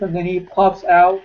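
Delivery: ordinary talk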